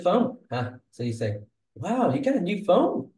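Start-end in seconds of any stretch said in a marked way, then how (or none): 1.7-3.1 high, bright amazed question; surprised. Shown as a demonstration. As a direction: gasp it out like you can't believe the new phone. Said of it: "So that's emotion".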